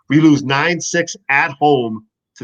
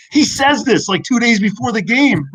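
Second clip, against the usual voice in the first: high-pitched, exclaiming disbelief; incredulous; 8 semitones higher, 3 dB louder than usual. Retold or imitated. Retelling a fan's outrageous TV threat, incredulous. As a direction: voice leaping up in outraged amazement.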